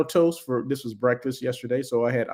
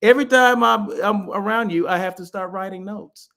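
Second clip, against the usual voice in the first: playful complaint, pitched up; good-natured ribbing, higher at the start; mock hard time; 6 semitones higher, 4 dB louder than usual. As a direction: tease her with a raised-voice gripe. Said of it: "I gave her a hard time".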